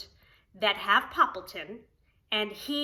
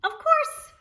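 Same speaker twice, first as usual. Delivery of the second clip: much higher, bright agreeable friend; Cherry Sue voice; 6 dB louder than usual. Acted. Cherry Sue agreeing to Poppleton, much higher eager voice. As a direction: chirp it up high as the kind neighbor.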